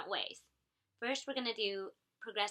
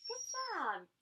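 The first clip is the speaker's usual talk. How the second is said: praise; 10 semitones higher than usual. Real, not acted. Praising child after success.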